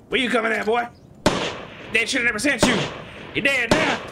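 swagger it like a cocky cowboy; put-on Southern accent. Gamer taunting enemies in a put-on Southern accent. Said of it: "So I'm gonna do this accent right here".

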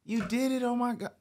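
a cured man's ecstatic high greeting; overjoyed man. Retold or imitated. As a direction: call out like a healed man overjoyed.

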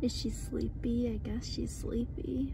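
soft hushed whisper. Real, not acted. Owner whispering beside the sleepy puppy, hushed.

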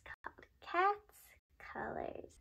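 high breathy whisper of the title. Acted.

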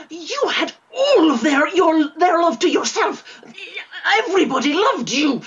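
tearful furious outburst; angry crying. Acted. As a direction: yell it through tears. Jealous twin accusing brother.

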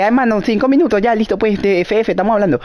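high-pitched mock plea from another player; imagined teammate. Voices what the next team might say.

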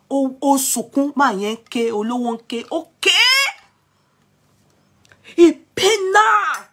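dramatic mock-wailing retell; loud excited retelling.

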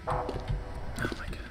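frightened whispered oh my god; scared whisper. Real, not acted. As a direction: breathe it out in a hushed whisper. Quiet frightened gasp in game.

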